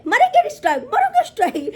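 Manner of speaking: high, loud taunting imitation; mock threat. Mocking the rival's threat. (ऊँची तीखी आवाज़ में धमकी की नकल)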